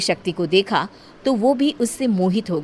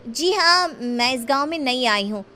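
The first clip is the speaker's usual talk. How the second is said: higher-pitched, sweet hesitant reply; coy young woman's voice; shy Shakti fibbing; 5 semitones higher than usual. Acted. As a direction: cover up politely, then ask back. Shakti answers Harsh.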